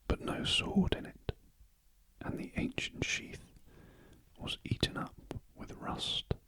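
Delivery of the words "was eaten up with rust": usual speaking voice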